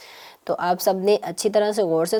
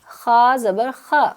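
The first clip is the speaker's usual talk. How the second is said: slow demo; 2 semitones higher, 4 dB louder, much slower than usual. Shown as a demonstration. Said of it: "teacher models syllable reading slowly".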